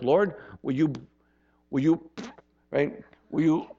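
halting stuttered prayer. Shown as a demonstration. Showing the church how a reluctant blessing prayer sticks in the throat, halting and stuttering. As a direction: demo a prayer that stalls on will you. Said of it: "It'll sound like this".

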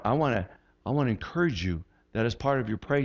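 usual speaking voice

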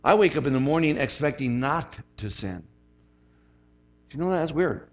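usual speaking voice

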